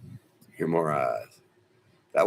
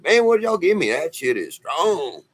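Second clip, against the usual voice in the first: amazed at the drug; 9 semitones higher, 3 dB louder than usual. Retold or imitated. Asking the nurse what she gave him, amazed. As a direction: marvel at how strong it hit.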